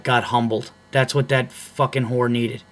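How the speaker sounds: ordinary delivery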